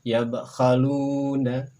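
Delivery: Yabkhaluna very slow, the b bouncing; slow Arabic demo. Shown as a demonstration. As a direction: spell out Yabkhaluna slowly. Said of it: "Berikutnya silakan".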